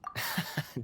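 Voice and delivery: quiet breathy chuckle; a light amused laugh under the breath; gentle knowing chuckle; soft chuckle. Acted. Kaya amused by Paimon's worry.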